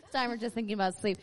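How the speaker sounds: normal talking voice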